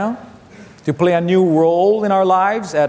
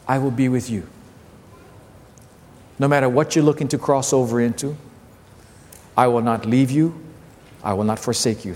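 tender promise; 5 semitones lower than usual. Acted. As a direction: ease down and comfort them. Soft close of promise.

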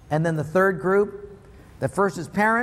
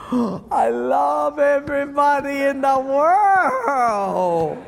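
slow, high, loud singsong wail; ecstatic gushing; 7 semitones higher, 5 dB louder, much slower than usual. Acted. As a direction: belt it out in drawn-out mock rapture. Ecstatic forgiveness after big check.

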